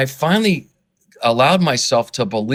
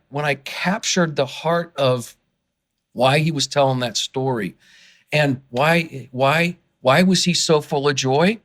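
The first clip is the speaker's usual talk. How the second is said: ordinary talk, nothing marked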